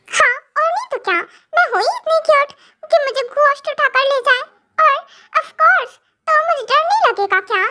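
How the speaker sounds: high, mock-teary girlish whine; hammed-up cutesy pout; cute childish wife. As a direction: act adorable and frightened. Wife claims she's cute and scared.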